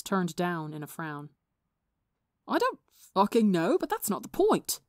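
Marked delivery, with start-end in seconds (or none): 2.5-4.8 loud, high exclamation; exclaiming. Acted. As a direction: burst out in frustration. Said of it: "Tommy exclaimed".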